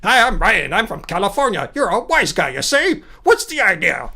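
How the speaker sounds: put-on old-time wise-guy patter; thirties movie voice. Streamer parodying a critic's imitation of them, old-fashioned movie voice. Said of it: "some kind of thirties movie".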